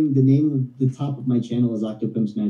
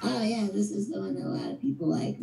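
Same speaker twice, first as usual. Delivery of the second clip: soft, put-on chipmunk voice; 7 semitones higher, 9 dB quieter than usual. Shown as a demonstration. Performer showing the voice people like, soft chipmunk voice. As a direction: chatter softly as a little cartoon chipmunk. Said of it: "You sound like a chipmunk".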